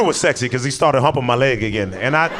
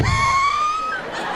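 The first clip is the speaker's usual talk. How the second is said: high squeaky held-in laugh; suppressed squeal laugh. Shown as a demonstration. Showing the stifled laugh at the cops, squeaky and high. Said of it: "that quiet laugh where you like".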